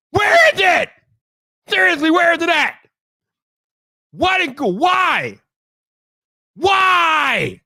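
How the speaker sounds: high-pitched, wailing outrage; annoyed rant at its peak; exasperated shouting. Real, not acted. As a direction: shout each question in disbelief. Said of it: "I gotta express some annoyance".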